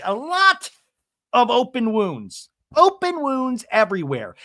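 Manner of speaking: mock-theatrical wounded star; dramatic lament. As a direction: overplay the hurt for effect. [name] motions through whole body.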